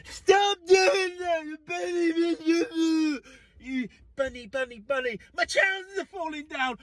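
hammed-up exasperated dad; frazzled parent shout. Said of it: "I was like".

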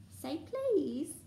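high, teasing singsong; Peeves. A narrator's reading. Poltergeist toying with the caretaker, annoying singsong. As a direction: taunt with a lilting demand. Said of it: "in his annoying singing vo".

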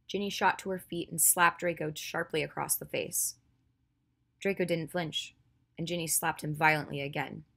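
ordinary delivery, nothing marked

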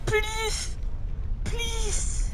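high, soft, drawn-out begging; pleading. Real, not acted. Begging as the fight goes badly.

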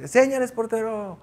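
high-pitched mock shout from the stands; imitating a fan yelling. Fan in the stands cheering the goalkeeper on, high mock yell. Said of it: "se escucha".

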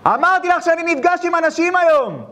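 high-pitched irritated yell; imitating an angry son. Businessman shouting at his mother during meeting, imitated anger. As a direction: repeat his rude shout at mom. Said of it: "ככה הוא ענה".

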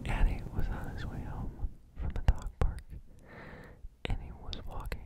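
ordinary delivery

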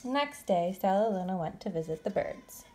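usual speaking voice

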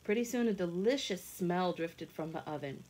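ordinary delivery, nothing marked

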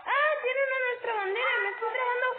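frantic game panic; panicked yelling. Real, not acted. Flag taken, stuck on something.

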